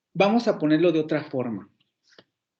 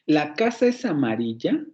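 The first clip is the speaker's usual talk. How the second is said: "¿La casa es amarilla?" rising at the end; as a question. Shown as a demonstration. Teacher repeats the question tune for the student, rising. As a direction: ask it with a clear rise. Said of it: "Pasa una entonación".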